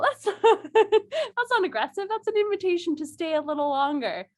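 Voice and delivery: ordinary talk, nothing marked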